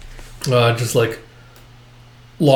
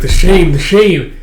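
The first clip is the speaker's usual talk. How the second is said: higher, louder lament; anguished wail; 6 semitones higher, 7 dB louder than usual. Acted. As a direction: cry out in torment. Ghost wails of shame.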